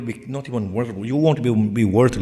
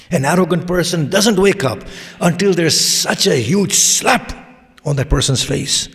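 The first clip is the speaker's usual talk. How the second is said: raised, emphatic preacher's voice; full-force preaching; 4 semitones higher, 5 dB louder than usual. Acted. Preacher warns that the arrogant need waking, forceful reprimand. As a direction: build to a hard hit on SLAP.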